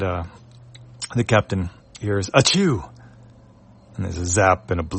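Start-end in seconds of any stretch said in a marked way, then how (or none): 2.3-2.9 a high sharp fake sneeze. Unseen creature sneezes.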